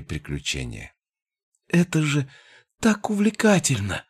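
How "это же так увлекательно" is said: much higher, thrilled exclamation; exclaiming. A narrator's reading. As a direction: gush with delight. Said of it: "восклицала она". (восторженно восклицая)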